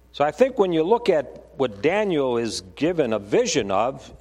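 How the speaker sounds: normal talking voice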